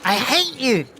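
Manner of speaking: high-pitched angry outburst at normal volume; shouting. Acted. Young Mary shouting at Castellan as she strides out, high-pitched mock shout. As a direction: protest it high, drawing out the words. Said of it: "is shouting at Castellan".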